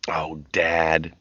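whispered, breathy groan; exasperated moan. Acted. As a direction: roll the words out with a sigh. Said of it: "Becky moaned".

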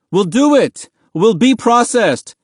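loud, high-pitched giving in; desperate shout. A narrator's reading. Boy telling guards they will comply, desperate shout. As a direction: shout your surrender urgently. Boy surrenders to stop beating.

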